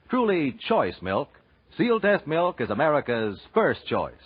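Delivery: normal talking voice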